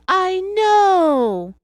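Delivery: loud, high-pitched imitated yell; retold yell. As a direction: yell it out high, drawing out the last word. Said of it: "And she yelled".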